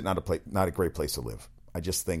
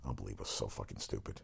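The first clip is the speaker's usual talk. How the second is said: much softer, lower, fed-up grumble to yourself; disgusted mutter; 12 semitones lower, 16 dB quieter than usual. Real, not acted. Host griping to himself about guns in the temple, muttered disgust. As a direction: drop way down and mutter it under your breath.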